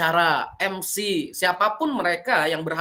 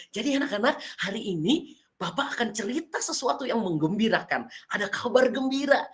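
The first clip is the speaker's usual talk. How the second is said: happy storyteller; 4 semitones higher, 3 dB quieter than usual. Shown as a demonstration. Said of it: "kalau ekspresi kan seneng gimana".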